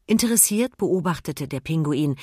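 usual speaking voice

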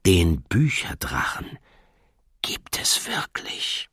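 slow hushed revelation; solemn whisper. Acted. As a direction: whisper the secret gravely. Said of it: "sagte dann feierlich".

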